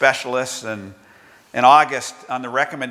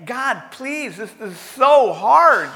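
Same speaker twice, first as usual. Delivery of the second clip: higher, slow, pleading voice; sermon begging voice, slowed and raised; 8 semitones higher than usual. Acted. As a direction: plead with God, acted and earnest. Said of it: "He begged".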